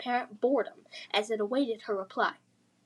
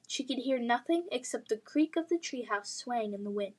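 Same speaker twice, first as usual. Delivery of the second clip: plain reading voice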